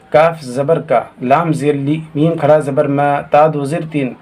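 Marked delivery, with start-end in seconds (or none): none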